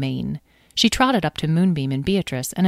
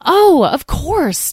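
much higher, drawn out very slowly; sudden realization; 12 semitones higher, 8 dB louder, much slower than usual. Acted. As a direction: let the penny drop slowly.

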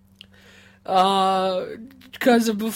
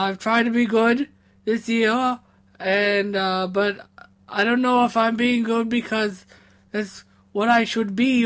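usual speaking voice

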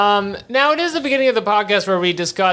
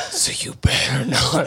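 whisper; 2 semitones lower than usual. Retold or imitated. Pretend pep talk to nervous cohost.

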